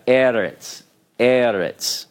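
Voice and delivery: "eretz" with first-syllable stress and a final ts; ts ending. Shown as a demonstration. Teacher spelling out a Hebrew word, "eretz" ending in ts. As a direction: end "eretz" on a crisp ts sound, accent up front. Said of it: "This is a ts".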